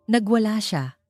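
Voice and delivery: usual speaking voice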